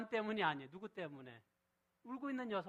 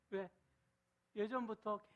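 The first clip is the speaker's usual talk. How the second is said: ordinary talk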